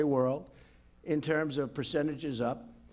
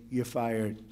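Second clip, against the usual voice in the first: softly, sweetly, lower firing line; mock gentle; 6 semitones lower, 4 dB quieter than usual. Firing a mistreating official with mock gentleness. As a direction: say "You're fired." with mock gentleness, softer and lower. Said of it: "very gently say".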